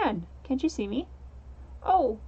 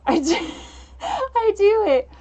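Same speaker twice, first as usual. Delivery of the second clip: laughing hard, high and loud; helpless, loud laughter through the words; 7 semitones higher, 7 dB louder, slower than usual. Real, not acted. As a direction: crack up while getting the words out. Laughing at own game dialogue.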